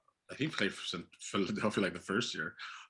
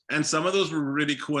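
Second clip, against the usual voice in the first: ordinary talk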